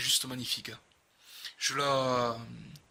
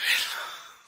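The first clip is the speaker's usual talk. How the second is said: amazed laugh; 4 dB louder than usual. Real, not acted. Reacting to the card.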